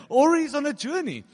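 high, loud singsong jeer; theatrical mock suggestion; mocking taunt. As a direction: toss out a sarcastic excuse. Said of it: "Elijah mocked them".

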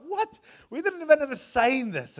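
very high, loud shocked protest; hammed-up indignant crowd; outraged disbelief. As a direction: squeal it like it's unfair. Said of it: "the people were crying".

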